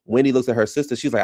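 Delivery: usual speaking voice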